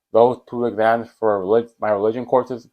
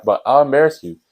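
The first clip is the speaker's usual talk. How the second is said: usual speaking voice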